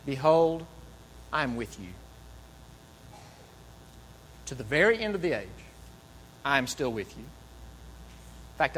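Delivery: tender promise. Retold or imitated. Jesus' parting words quoted.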